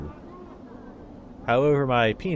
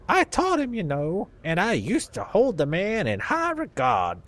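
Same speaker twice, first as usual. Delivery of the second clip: put-on old Southern man, pitched much higher; southern accent; 7 semitones higher than usual. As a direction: play a crusty old Southern dustman in a Southern accent. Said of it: "I defaultly want to give old people southern accents".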